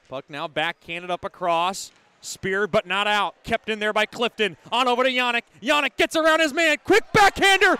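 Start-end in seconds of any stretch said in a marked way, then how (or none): 4.7-7.7 building from keen to high and loud; rising excitement. Real, not acted. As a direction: climb with the play toward the shot. Goal chance builds in play-by-play.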